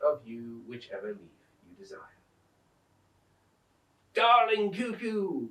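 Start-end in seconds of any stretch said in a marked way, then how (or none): 4.1-5.5 high, slow eager call; cried out. A narrator's reading. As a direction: call sweetly up to the bird. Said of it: "cried Scrub".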